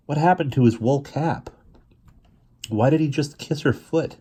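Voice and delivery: ordinary delivery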